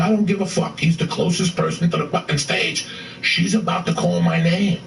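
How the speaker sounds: exasperated quote, pitch pushed up; retold exasperation. Comic tells a friend he's about to be called on stage, exasperated. Panicking, snaps at friend in story.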